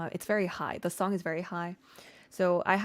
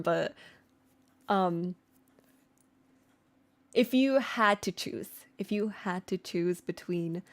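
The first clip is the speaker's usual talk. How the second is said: normal talking voice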